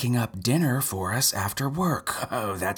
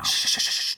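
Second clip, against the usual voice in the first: urgent shushing. Real, not acted.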